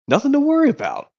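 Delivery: brisk, high, mock-cheerful Woody; Woody reassuring. A lightly voiced Woody telling his friends not to worry. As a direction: rattle it off to calm the troops. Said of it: "Woody tells his friends saying".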